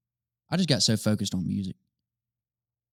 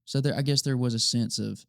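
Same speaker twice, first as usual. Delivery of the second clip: ordinary talk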